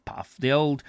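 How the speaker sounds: ordinary delivery, nothing marked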